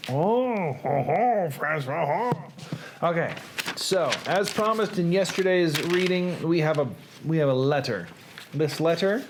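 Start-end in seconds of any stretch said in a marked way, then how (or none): 0.1-3.1 mock French. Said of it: "but in French".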